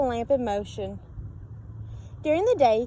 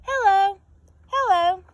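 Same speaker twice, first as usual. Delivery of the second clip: sing-song hello; 7 semitones higher, 4 dB louder than usual. A narrator's reading. Lighthouse calling refrain.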